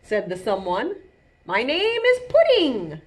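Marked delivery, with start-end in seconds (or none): 1.5-3.0 high, louder storybook snail; snail introducing itself. A narrator's reading. Pudding meeting the forest animals, introducing itself. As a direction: announce your name in a raised little voice.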